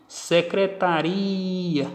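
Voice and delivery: Secretaria slow, RI held very long; stress on penultimate syllable. Shown as a demonstration. Teacher showing the tonic syllable, Secretaria with a stretched RI. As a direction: draw out RI before the light A in Secretaria. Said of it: "penúltima".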